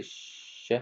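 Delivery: breathy slow ch sound; whispered sound. Shown as a demonstration. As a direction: whisper Ça fait ch, the ch hissed slowly. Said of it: "Ça fait quoi?".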